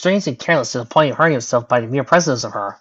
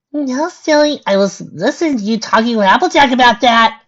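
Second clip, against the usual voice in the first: high-pitched, teasing silly voice; playful bubbly character; 10 semitones higher, 6 dB louder than usual. A narrator's reading. Pinkie explains to her friend how she knew, playfully. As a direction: answer high and lively, getting louder.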